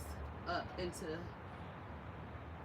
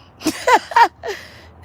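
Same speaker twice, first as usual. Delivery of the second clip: high laugh; 5 dB louder than usual. Real, not acted. Laughs at own mistake.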